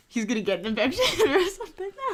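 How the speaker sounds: fast laughing aside. Real, not acted. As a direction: laugh through it quickly. Laughing joke during reading.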